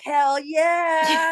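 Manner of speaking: high, drawn-out hell yeah; gleeful, stretched whoop; excited cheer. Acted. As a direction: shout it like a thrilled fan.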